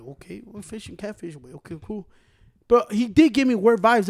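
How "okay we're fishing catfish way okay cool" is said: much softer, low-key answer; quietly humoring the stranger who asked about fishing; soft reply. Retold or imitated. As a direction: keep it down and easygoing. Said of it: "I was like".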